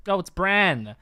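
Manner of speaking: loud, eager answer shouts; shouting pictionary guesses. Real, not acted. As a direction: yell the guess, sure you've got it. Excited guessing at drawing.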